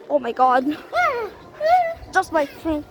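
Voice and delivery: ordinary talk, nothing marked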